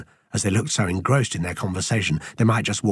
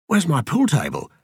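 high-pitched, dismayed outcry; cry of dismay; 8 semitones higher than usual. A narrator's reading. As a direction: cry out at a sudden loss. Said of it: "he cried out in dismay".

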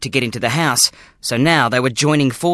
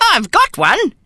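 put-on old grandfather voice; 12 semitones higher, 3 dB louder than usual. Gramps voiced, chuckling.

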